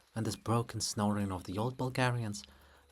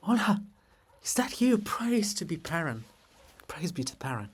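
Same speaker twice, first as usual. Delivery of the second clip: high, loud gasp of surprise into a greeting; startled greeting; 8 semitones higher, 5 dB louder than usual. Acted. Libet startled as Olha appears, a loud high gasping greeting. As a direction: jump up in pitch, caught off guard and delighted.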